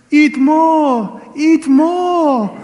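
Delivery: very high, loud German grandma urging; high hammed-up German lady insisting. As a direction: squeal it like a pushy German hostess. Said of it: "her famous".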